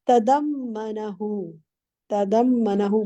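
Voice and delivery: "तदम्मनहु" slow, the m doubled; slow model reading. Shown as a demonstration. Teacher models the word and asks the student to repeat. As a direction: stretch "तदम्मनहु" with a held mm. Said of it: "बोले इस लफ्ज को".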